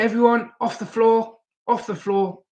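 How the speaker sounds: high-pitched imitation of a bossy order; mock holler, pitched well up; mock calling out. Groom's sister clearing the dance floor, imitated bossy call.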